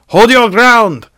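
high, very loud battle cry; desperate yell. A narrator's reading. Elven commander rallies the defenders, desperate full-voiced yell. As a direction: yell it drawn out over the fighting. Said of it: "Celebrimbor yelled desperately".

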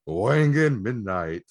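drawn-out, swooping announcer excitement; slow, playful hype announcement; hyped title reveal. Pretend. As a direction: belt the title out slowly with showy hype. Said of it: "I like the other way".

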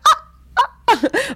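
very high loud ahh of fervor; ecstatic cry. Retold or imitated. Blasting in tongues at night, crying out very high. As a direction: cry out in fervent prayer. Fervent cry while praying.

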